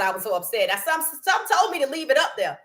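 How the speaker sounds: ordinary talk, nothing marked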